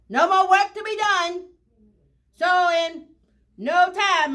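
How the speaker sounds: ordinary delivery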